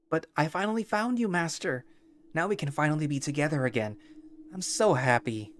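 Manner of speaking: high, gushing creature voice reunited with its master; overjoyed creature. Acted. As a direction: squeal with delight at finding the master.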